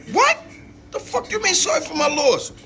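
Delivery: sudden high disbelieving cry, imitated; stunned disbelief. Mother hearing her son is dead: high shocked disbelief. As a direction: squeak it up in shocked outrage, half-acted.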